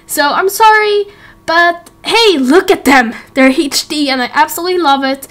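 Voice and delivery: ordinary talk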